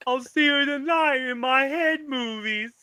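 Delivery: high simpleton voice through laughter; Simple Jack voice. Retold or imitated. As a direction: laugh while doing the dopey voice. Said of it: "Doesn't he say that?".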